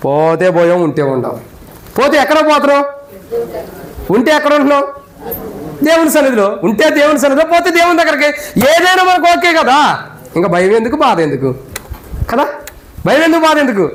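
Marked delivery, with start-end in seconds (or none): 2.0-13.9 raised, impassioned pulpit voice, driving each question home; preaching at full force. Acted. Pastor to funeral congregation, preaching at full force.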